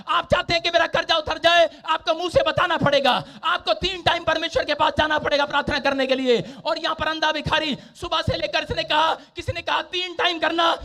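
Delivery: full-force preaching. Acted. Preacher urging the sick to ask God aloud, full-force preaching. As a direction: drive each instruction home to the congregation.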